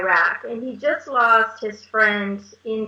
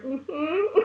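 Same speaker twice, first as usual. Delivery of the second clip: stifled laugh; 5 semitones higher, 6 dB quieter than usual. Real, not acted.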